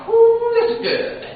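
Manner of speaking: high, slow, delighted whoo; thrilled inside but keeping it to yourself; silent inner cheer. Retold or imitated. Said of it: "I didn't say that out loud".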